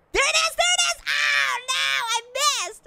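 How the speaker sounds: very high-pitched shouting, tipping into dismay; frantic excitement. Real, not acted. Player nearly reaches the blimp, frantic shout. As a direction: squeal as the chance slips away. Near miss in game.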